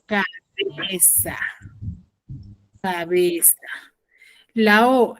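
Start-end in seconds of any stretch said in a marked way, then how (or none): 0.1-3.6 very slow, drawn-out "Cabeza"; over enunciating. Shown as a demonstration. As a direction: stretch "Cabeza" out very slowly. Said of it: "teacher models the answer".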